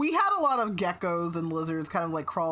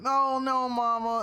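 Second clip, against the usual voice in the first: choked up; 6 semitones higher, 4 dB louder than usual. Real, not acted. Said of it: "everyone is allowed to cry".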